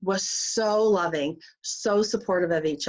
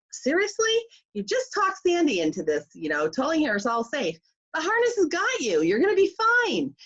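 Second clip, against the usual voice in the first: incredulous guide; 8 semitones higher than usual. Retold or imitated.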